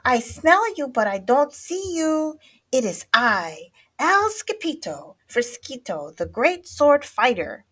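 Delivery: high, loud heroic announcement; calling out. A narrator's reading. Skipito presents himself as great sword fighter, calling out. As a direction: announce yourself grandly to hidden friends. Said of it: "called out Skippy John Jones".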